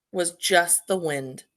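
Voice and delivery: ordinary delivery, nothing marked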